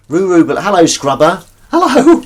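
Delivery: loud, high-pitched hello; calling out a greeting. Real, not acted. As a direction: sing out hello to whoever just joined. Calling hello to arriving viewers.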